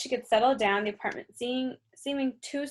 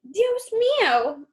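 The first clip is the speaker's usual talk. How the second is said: put-on high, slow, overblown Mexican accent; mock dismay in a hammed-up Mexican accent; exaggerated Mexican accent; 12 semitones higher, 5 dB louder, much slower than usual. Officer mocking Pow in a hammed-up Mexican accent. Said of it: "an exaggerated Mexican accent".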